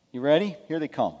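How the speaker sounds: ordinary delivery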